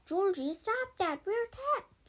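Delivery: high, loud frightened yell; yelling at Georgie to stop rocking; panicked shout. A narrator's reading. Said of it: "Sage shouted".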